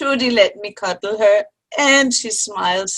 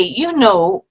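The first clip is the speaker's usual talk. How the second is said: ordinary talk, nothing marked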